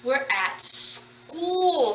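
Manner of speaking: slow, deliberate modeling of school. Shown as a demonstration. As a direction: draw the words out for learners. Teacher models the word slowly.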